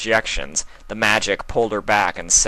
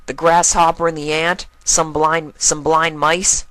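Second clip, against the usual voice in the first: cheeky colt; 9 semitones higher, 5 dB louder than usual. Acted. Colt teasing Twilight about her stories, higher and cheeky. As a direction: poke fun at old stories.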